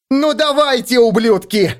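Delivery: high, loud furious yell. A narrator's reading. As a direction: yell a challenge at them. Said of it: "заорала она".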